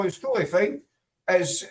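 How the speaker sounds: ordinary talk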